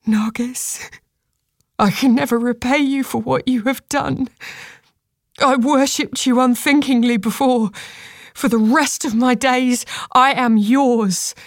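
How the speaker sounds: reverent, whispered-then-voiced thanks; fervent prayer. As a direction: vow gratitude to a goddess, slowing at the end. Tearful prayer of thanks to deity.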